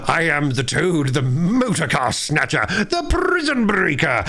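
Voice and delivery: slow, high, swaggering proclamation; boastful Toad. A narrator's reading. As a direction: crow your own glory grandly. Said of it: "he shouted recklessly".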